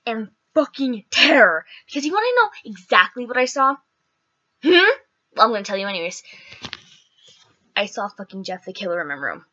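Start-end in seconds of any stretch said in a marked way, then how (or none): none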